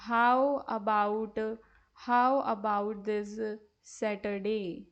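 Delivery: very slow, word by word, for students to copy; slow dictation. Shown as a demonstration. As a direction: spell it out slowly like dictation. Teacher dictates Ravi's line.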